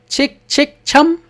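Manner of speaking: light, tinkly anklet sound; anklet jingle imitation. Shown as a demonstration. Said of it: "पायल की ध्वनि कैसे होती है?".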